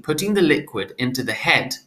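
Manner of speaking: ordinary delivery, nothing marked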